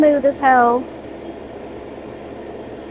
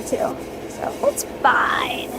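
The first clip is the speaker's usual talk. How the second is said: whispering; 5 dB quieter, slower than usual. Real, not acted. Trailing whisper to herself.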